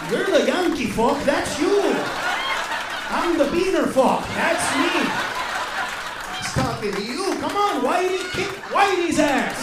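loud mocking yell; egging-on shout. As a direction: hype up the fight, loudly. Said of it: "I'm like".

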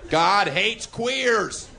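slow drawn-out protest chant. Pretend. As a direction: bellow it like a picket-line bigot. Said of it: "me pretending to be a right-wing redneck".

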